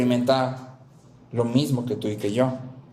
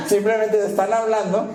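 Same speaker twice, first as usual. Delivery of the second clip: higher-pitched, speaking through a laugh; 8 semitones higher than usual. Real, not acted. Preacher resuming his point after an "ups", laughing. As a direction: let real laughter spill into the words.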